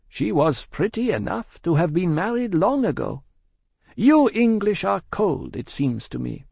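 high-pitched Madame, measured report; Madame, cool shopkeeper. A narrator's reading. Madame tells the spy of lapsed letters, high and measured.

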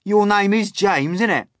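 high, loud bug voice; brash Centipede. A narrator's reading. Centipede checks the boy's name, brash. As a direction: quiz him loudly.

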